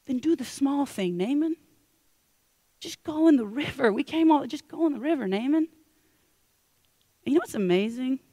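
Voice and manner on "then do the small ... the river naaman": coaxing plea. Acted. Servants pleading with their master.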